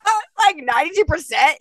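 high, loud mock indignation; mock outrage. Real, not acted. Laughing at her own test result, mock outraged. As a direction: pretend to be scandalised by the number. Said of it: "mock outrage".

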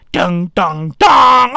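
mock dramatic dun-dun-dun, last one loud; low hits building to a loud final dun; suspense sting. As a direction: voice a cheesy cliffhanger sting. Voices suspense sting after rabies.

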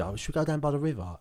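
ordinary talk

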